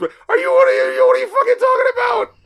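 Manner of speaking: high, loud incredulous yell; outraged shout. Real, not acted. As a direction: shout it in real disbelief. Outraged at annotation praising song.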